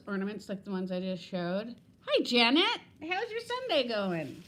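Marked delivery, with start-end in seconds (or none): 2.1-4.4 cheery greeting. Real, not acted. Greeting viewer joining the live.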